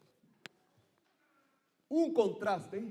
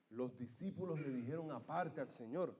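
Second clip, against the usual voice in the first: usual speaking voice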